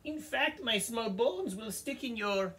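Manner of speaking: very high, squeaky goat voice; squeaky little goat. Acted. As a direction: plead in a tiny squeak.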